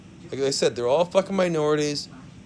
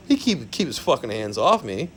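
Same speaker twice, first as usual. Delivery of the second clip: normal talking voice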